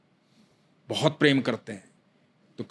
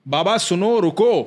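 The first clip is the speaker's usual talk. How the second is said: put-on higher, louder boy's call; 6 semitones higher, 5 dB louder, slower than usual. Boy calling louder to the walking devotee, loud. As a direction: shout after him to stop. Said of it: "बच्चे ने थोड़ा तेज आवाज दी".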